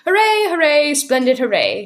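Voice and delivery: high, loud delighted cry; high shrill hooray; shrill little cheer. A narrator's reading. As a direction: cheer like a gleeful tiny old woman. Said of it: "in her shrill little voice".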